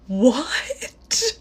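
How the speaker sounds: high, drawn-out "what"; retold shock. Woman hearing date really wrote for the paper, acted shock. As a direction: act out stunned disbelief. Shocked by date's newspaper revelation.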